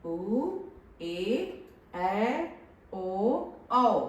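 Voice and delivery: long, separate vowels, each held about twice as long; drawn-out vowels. Shown as a demonstration. Teacher showing pupils that long vowels take double time, drawn out. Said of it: "तो ह्रस्व स्वर के उच्चारण से".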